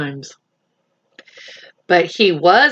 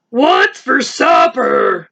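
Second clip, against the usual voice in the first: hungry lion; 4 semitones higher, slower than usual. Acted. Lion asks the rabbit.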